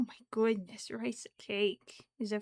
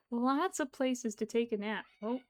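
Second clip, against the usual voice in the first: normal talking voice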